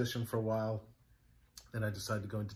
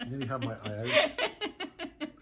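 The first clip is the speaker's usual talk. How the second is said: laughing; slower than usual. Real, not acted. Laughing at near-miss injury.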